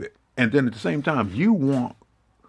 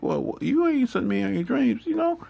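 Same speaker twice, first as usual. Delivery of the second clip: much higher, sulky mock whine; whiny complaint; 14 semitones higher than usual. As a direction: whine it like a sulking kid. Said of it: "I was like".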